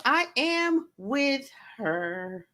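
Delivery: slow, stretched, emphatic; drawn-out agreement. Real, not acted. Backing up a viewer's comment, slow and stretched. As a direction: draw every word out slowly.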